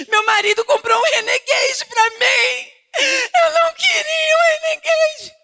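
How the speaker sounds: high, loud, fake-crying whine; mock wailing complaint. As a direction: ham up a spoiled wife sobbing. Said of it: "tô com raiva". (choramingo exagerado e agudo de esposa mimada)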